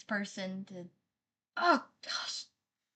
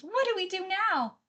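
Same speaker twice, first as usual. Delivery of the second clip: higher, louder mock plea for ideas; voicing chat question; 8 semitones higher, 6 dB louder than usual. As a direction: play the chatter asking helplessly. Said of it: "Rusty Shine says".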